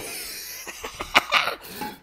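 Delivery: breathy, voiceless laugh; a laugh he tries to hold in; stifled laugh. Real, not acted. Laughs after seeing something inappropriate.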